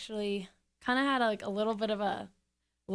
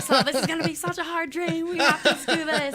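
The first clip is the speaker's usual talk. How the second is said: higher and louder, laughing with eagerness; excited laugh; 7 semitones higher, 8 dB louder than usual. Retold or imitated. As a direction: fire him up, half laughing. Pushing Alex, laughing, fired up.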